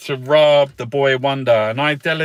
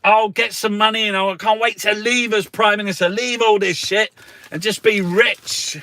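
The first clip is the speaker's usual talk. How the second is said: higher, smug mocking politician voice; lightly mocking posh minister; mocking impression; 7 semitones higher than usual. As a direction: send up the PM gleefully. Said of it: "He said".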